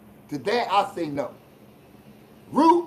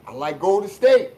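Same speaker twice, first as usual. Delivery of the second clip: ordinary delivery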